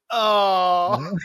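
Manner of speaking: loud collective oh from the crowd; audience groan. Retold or imitated. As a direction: imitate a shocked audience going oh. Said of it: "audience audibly go like".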